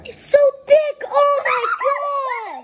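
high, loud gross-out cry; shocked disgust. Real, not acted. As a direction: react to a thick sludge in horror. Tasting thick drink.

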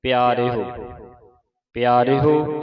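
ਪਿਆਰੇ ਹੋ, loud with a sounded final ਹੋ; emphatic pronunciation demo. Shown as a demonstration. Teacher shows how to read it, ਪਿਆਰੇ ਹੋ loud and distinct. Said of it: "ਇਸ ਤਰ੍ਹਾਂ ਪੜ੍ਹਨਾ ਹੈ".

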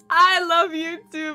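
weeping with joy; tearful reunion. Acted. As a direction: choke up with relief. Fran reunited with her cat.

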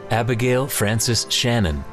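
ordinary talk

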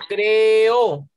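"creo" stretched long, cre-o; slow classroom split of "creo"; separated vowels. Shown as a demonstration. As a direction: hold the E of "creo" before the O. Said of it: "Hay dos vocales abiertas".

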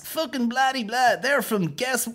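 high, loud sneering impression of a Dublin comic; high, loud mock southern patter. Mocking Dublin comedians' sets.

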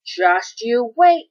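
slow, loud, seething threat; furious vow. A narrator's reading. Tree shaking at the hedgehog, slow furious vow. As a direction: draw it out through fury. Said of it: "trembled with fury".

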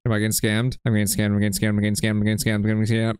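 low repeated groan of dismay. Real, not acted. Gamer realizing he was scammed, low and dismayed. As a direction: mutter it over and over, crushed.

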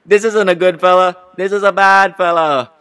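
high, very loud threatening shout; hot-headed tough-guy tirade; upset eruption. Acted. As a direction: blow up at the lawyer. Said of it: "Suddenly upset".

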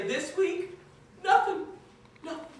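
high, broken mock sob; mock sobbing. The lucky man sobs his punchline to the congregation, mock crying. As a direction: squeeze the words out between fake sobs. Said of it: "stop sobbing long enough to say".

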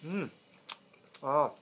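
chewing while humming; closed-mouth chewing murmur; mouth full of pizza. Real, not acted. Seen 0: Bites pizza at -2, cheeks bulge, chewing with closed mouth throughout.

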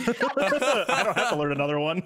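giggling mock boast; laughing smug joke. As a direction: laugh through the cheeky brag. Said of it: "Joke's on you".

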